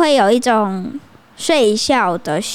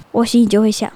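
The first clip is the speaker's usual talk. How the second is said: ordinary talk, nothing marked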